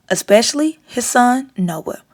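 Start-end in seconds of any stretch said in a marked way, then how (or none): none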